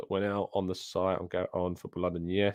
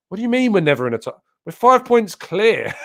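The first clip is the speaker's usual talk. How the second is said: incredulous; 10 semitones higher, 8 dB louder than usual. Real, not acted. Incredulous reply to viewer comment.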